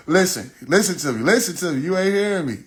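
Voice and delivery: high, forceful acted appeal; insistent. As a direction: plead loudly over the officer. Acting himself arguing with officer.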